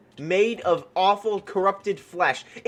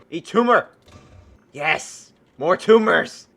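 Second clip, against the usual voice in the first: battle cry; 5 dB louder, slower than usual. Real, not acted. Firing tumor weapon again.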